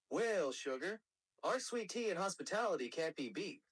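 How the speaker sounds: Southern American character; Southern US accent. Shown as a demonstration. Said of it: "each one representing those accents".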